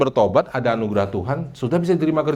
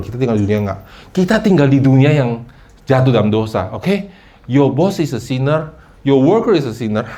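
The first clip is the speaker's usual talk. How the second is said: ordinary delivery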